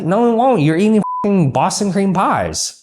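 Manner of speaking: ordinary talk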